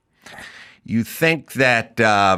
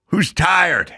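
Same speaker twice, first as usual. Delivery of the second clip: higher, teasing drill coach voice; mock coach taunt; 7 semitones higher than usual. Coach taunting worn-out players, mock coach taunt. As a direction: bait them like a smug coach. Coaches asking trick question.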